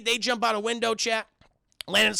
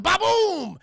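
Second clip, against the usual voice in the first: loud, high "ba-boom"; hammed-up magic blast noise; imitated explosion; 10 semitones higher, 5 dB louder than usual. Imitates magic dagger going off.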